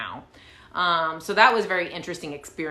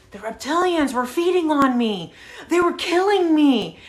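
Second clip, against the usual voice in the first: high, loud mock-desperate complaint; imitated panic, building higher and louder; dramatic distress; 9 semitones higher, 6 dB louder than usual. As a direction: plead as a hammed-up victim. Man dramatically retells his ordeal.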